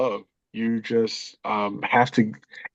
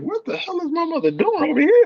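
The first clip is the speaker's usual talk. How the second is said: higher, incredulous inner voice; mock disbelief, voice jumping higher; retold disbelief; 14 semitones higher than usual. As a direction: play the son's shocked thought, pitched up. Son shocked at mother's stop.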